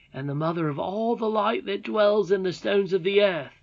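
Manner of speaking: put-on a young miner boy asking boldly; eager boy Curdie.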